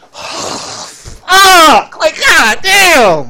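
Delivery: high, loud, slow hammed-up wail; mock anguish. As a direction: overplay the despair on purpose.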